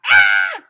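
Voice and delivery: sudden loud scream; a short shrieking "Ah!" of panic; girl's scream. Acted. Girl screams at the bear in class, loud shriek.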